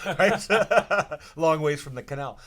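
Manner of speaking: laughing loudly through the words; a real burst of joyous laughter. Real, not acted. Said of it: "laugh heard, recalling dream".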